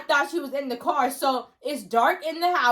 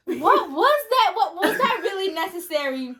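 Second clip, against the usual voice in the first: high, loud, amused protest; laughing disbelief; 5 semitones higher, 3 dB louder than usual. Retold or imitated. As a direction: draw it out through a laugh. Daughter reacts, laughing, to mom's act.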